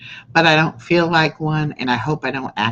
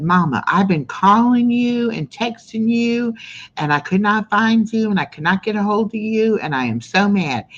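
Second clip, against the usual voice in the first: mad daughter; 8 semitones higher than usual. Retold or imitated. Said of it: "And she was so mad".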